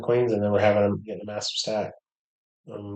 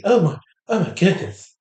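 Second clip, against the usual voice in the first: much higher, louder hammed-up granny; old lady voice; 11 semitones higher, 10 dB louder than usual. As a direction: play up a shocked old lady, overdone. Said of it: "Why do I sound like an old lady?".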